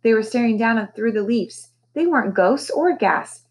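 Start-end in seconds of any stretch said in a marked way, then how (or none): none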